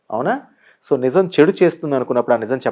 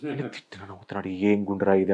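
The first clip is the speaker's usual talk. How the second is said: low, much softer grumble; muttered thought; 7 semitones lower, 13 dB quieter than usual. Retold or imitated. Insulted man muttering to himself after being smiled at again, low and soft. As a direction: mutter it, baffled and annoyed. Imagined thought, second encounter.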